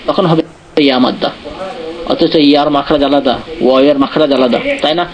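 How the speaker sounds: ordinary delivery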